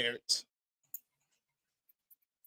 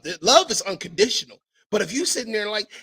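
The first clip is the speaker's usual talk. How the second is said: usual speaking voice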